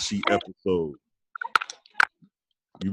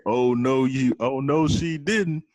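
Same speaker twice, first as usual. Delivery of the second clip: sassy oh no she didn't; 6 semitones higher, much slower than usual. Retold or imitated. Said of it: "I remember you saying".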